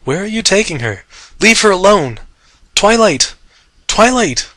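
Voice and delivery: high raging cry; wild wounded rage. Acted. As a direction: rage and hurt at full voice. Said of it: "he raged in wild, wounded tones".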